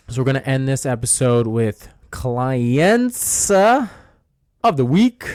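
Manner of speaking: big, slow, high announcer intro. Pretend. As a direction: hype up the segment like a game show host. Announcing the clients of the week segment.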